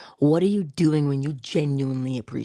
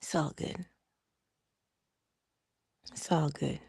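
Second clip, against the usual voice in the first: soft, quiet resigned letting go; 10 dB quieter than usual. Retold or imitated. Talking herself down after the parking spot, quiet and resigned. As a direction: murmur it to calm yourself. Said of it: "I said".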